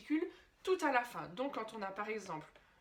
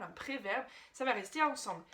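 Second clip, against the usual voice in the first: ordinary talk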